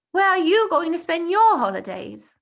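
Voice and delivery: put-on eager young boy Bertie, showing off; eager schoolboy. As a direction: brag about the holidays like an excited schoolboy. Bertie asks Tom.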